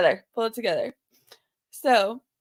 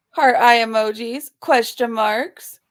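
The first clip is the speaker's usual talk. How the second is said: normal talking voice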